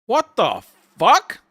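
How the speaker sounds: real disbelief at the news; stunned disbelief. Real, not acted. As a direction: drag it out in shock. Stunned reaction to crash news.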